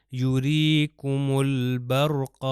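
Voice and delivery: یوریکم البرق slowly chanted, lam in البرق clearly sounded; Quran recitation. Shown as a demonstration. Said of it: "لام کو میں تلفظ کر رہا ہوں".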